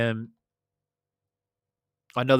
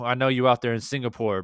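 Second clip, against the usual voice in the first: normal talking voice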